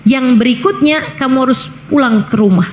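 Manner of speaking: ordinary talk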